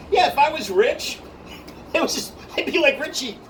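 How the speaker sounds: high, loud mock exasperation; animated retold rant. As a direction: act out an over-the-top plea to a friend. Acting out his own heated quip.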